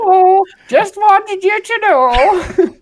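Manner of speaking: very high, slow falsetto with a laugh; message voice. Retold or imitated. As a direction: giggle through a squeaky greeting. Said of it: "I can't even do the voice".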